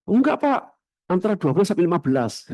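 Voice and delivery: high, quick haggling; mock greedy ustadz voice. Ustadz on the phone raising his fee, high and quick. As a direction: raise the price with a cheeky squeak.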